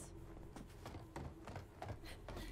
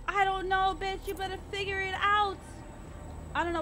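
high, loud desperate shouting; frantic panicked yelling; 6 semitones higher, 6 dB louder than usual. Real, not acted. Monster chasing, frantic yelling.

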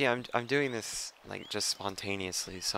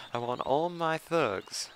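high, loud, drawn-out mock Italian; bad Italian accent; 7 semitones higher, 6 dB louder, slower than usual. Gamer retrying a boss calling his thugs, bad Italian accent. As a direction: ham up a failed Italian accent, slowly. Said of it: "the word thug just comes out in that voice".

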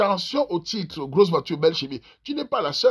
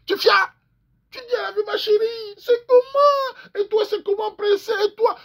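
hammed-up lover; 13 semitones higher than usual.

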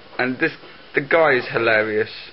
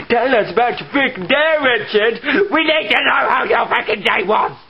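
louder, higher building yell; mock rant at Richard; 9 semitones higher, 4 dB louder than usual. Shouting at Richard.

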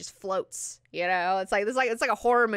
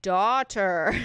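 condescending burn; 5 dB louder than usual. Acted. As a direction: put her in her place, leaning hard on "daughter". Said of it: "the second burn".

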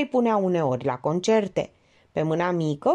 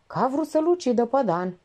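ordinary delivery

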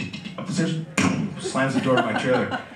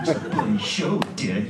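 put-on furious sulking kid; 4 semitones lower than usual. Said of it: "He was furious".

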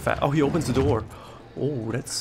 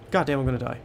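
ordinary talk, nothing marked

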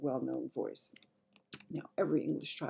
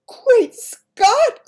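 very high, loud royal exclamation; shocked cry; 17 dB louder than usual. Acted. Queen on seeing the headlines, shocked cry. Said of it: "cried the famous voice".

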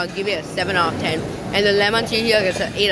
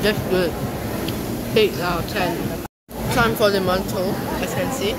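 chewing while talking; mouth partly full. Real, not acted. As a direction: food bits on lips. Seen 2: Rice bits on lips, jaw working after the sushi bite at -5 s.

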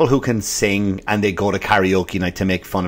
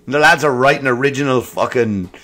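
ordinary talk, nothing marked

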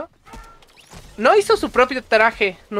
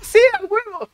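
much higher, louder joyful yes; excited cheer; 11 semitones higher, 5 dB louder than usual. Retold or imitated. Holding his girlfriend's hand at the movie, an excited cheer. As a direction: cheer like a kid in the cinema. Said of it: "Y sí, dije".

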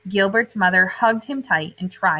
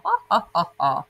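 mock laugh. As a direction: fake a silly giggle. Laughing at clownfish aside.

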